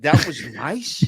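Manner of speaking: slow, sarcastic rising question; sarcastic disbelief. Retold or imitated. Traveller mocking the agent's idea of nice, slow and sarcastic. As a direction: drawl out the disbelief. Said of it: "I said".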